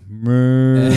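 loud bleating Meh; mock bleat. Sneaking up on a friend's father, a loud silly bleat. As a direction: bleat Meh loudly behind his back. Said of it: "walk up behind him and go".

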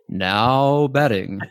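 slow, drawn-out stadium call; PA announcer voice. Shown as a demonstration. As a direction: announce it to the ballpark. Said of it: "do you make your voice like".

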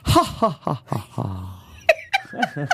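staged "ha ha ha" laugh; fake laugh sound effect. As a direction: spell out a hammed-up laugh. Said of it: "I love doing that".

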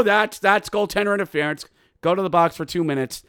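ordinary talk, nothing marked

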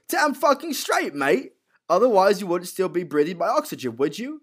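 high, loud put-on gangster voice; hammed-up, high-pitched gangster retort; Alfie. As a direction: throw the line out high and forceful, in character. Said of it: "And he goes".

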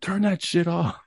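slow, high-pitched mock complaint; imitated heckler. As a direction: imitate an annoyed passer-by, drawn out and high. Imitates annoyed people in traffic.

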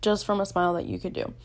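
usual speaking voice

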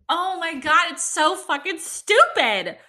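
loud, high, slow laughing rant; laughing exasperation. Real, not acted. Fed up with online critics, laughing loud exasperation.

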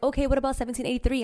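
high, fast mock recruiter haggling. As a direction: rattle off the new offer. Mocking the recruiter's offer.

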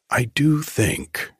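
carefully weighed, deliberate opening. A narrator's reading. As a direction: choose each word with care. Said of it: "he said carefully".

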